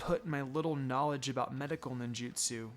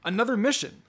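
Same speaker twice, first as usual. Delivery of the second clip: bright, eager burst, a bit higher and louder; boy thrilled to get another mission, excited; 5 semitones higher, 6 dB louder than usual. Acted. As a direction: jump at the news of another mission. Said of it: "Satoshi said excitedly".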